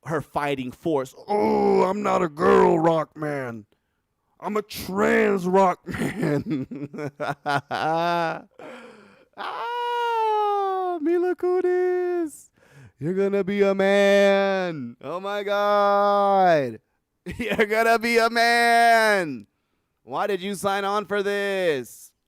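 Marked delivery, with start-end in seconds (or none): 1.3-6.7 loud, drawn-out mock hero shout; booming cartoon fighter voice; hammed-up battle cry. Acts out the character's battle cry. 6.7-9.0 loud burst of laughter; big uncontrolled laugh; real laughing fit. Real, not acted. Cracking up at own joke. 9.4-22.1 loud, slow, high wailing through laughter; drawn-out hysterical lament; mock anguished wail.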